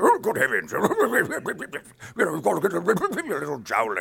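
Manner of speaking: high, loud British colonel with a laugh; laughing pompous British colonel; Mister Harriman voice. Retold or imitated. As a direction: bluster like an old colonel. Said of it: "I'm Mister Harriman".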